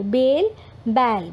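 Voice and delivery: बेल with a closed e, then बैल with an open ai, both slow; बेल versus बैल. Shown as a demonstration. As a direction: stretch बेल and बैल to contrast the vowels. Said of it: "sound का difference समझना है". (बेल और बैल धीरे-धीरे अलग करके)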